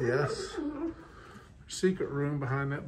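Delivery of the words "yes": laughing. Real, not acted. Delighted at finding secret room.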